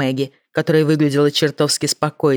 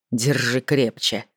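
low firm order; 3 semitones lower, 4 dB quieter, slower than usual. Acted. Jake orders Mancuso, slow low voice.

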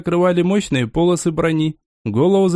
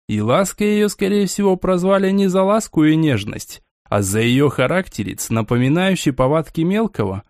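ordinary delivery, nothing marked

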